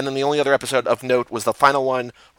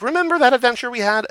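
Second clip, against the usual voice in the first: mock nostalgia; 11 semitones higher, faster than usual. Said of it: "we're just like".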